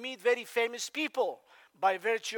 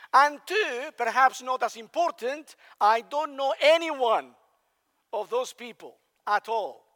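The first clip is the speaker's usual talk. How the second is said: ordinary delivery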